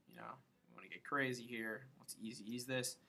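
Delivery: normal talking voice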